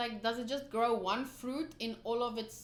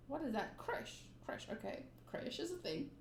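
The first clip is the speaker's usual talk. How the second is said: usual speaking voice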